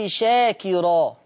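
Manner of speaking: شاكرا said very slowly, the ر light and thin; drawn-out recitation. Shown as a demonstration. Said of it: "لفظ".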